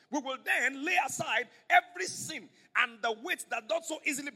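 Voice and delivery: fervent sermon climax; preaching at full force. Acted. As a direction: build the scripture to a ringing peak.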